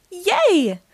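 put-on high cheer of two happy girls; excited shout. A narrator's reading. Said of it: "shout Millie and Melody".

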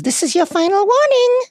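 very high, loud sugary villain voice; sickly sweet. Acted. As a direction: threaten in a syrupy, piping voice. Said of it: "sickly, sweet voice answers".

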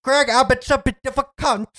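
high, badly faked Cockney; British accent. Man asked to prove he sounds British, fake British accent. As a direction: put on an unconvincing British accent. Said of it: "can you say it in a very convincing British".

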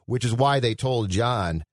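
normal talking voice

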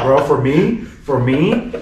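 ordinary delivery

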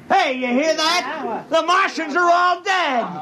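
a crewman rallies the men to break out the bottles, rowdy and shouting; rowdy drunken cheer. Acted. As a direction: whip everyone up to party, shouting and laughing. Drunken crew starts celebrating.

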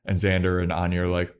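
ordinary talk

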